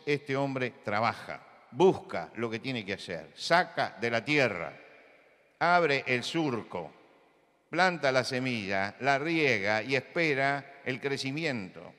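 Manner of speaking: usual speaking voice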